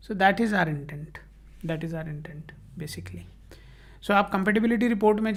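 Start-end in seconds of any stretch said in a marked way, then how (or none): 1.7-3.2 breathy whisper, much softer; hushed whispered echo of the point; whispered aside. Real, not acted. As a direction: drop to a whisper for the repeat. Repeats intent line as aside.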